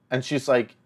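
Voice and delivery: ordinary talk, nothing marked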